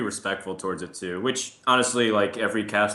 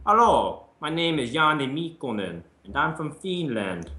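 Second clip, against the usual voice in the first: higher-pitched, put-on Finnish accent; Savage's Finnish accent; 7 semitones higher than usual. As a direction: introduce yourself in an imitated Finnish accent. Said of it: "he just disarms you with his accent".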